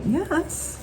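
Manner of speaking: high, proud shop owner; lightly voiced owner showing off; a hint of a proud owner; quoting the owner. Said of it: "She's like".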